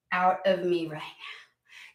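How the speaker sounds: slow unvoiced whisper, desperate; exasperated whisper. Fed-up pregnant woman begging the baby out, slow whisper. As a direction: hiss it out slowly like a worn-out mother-to-be.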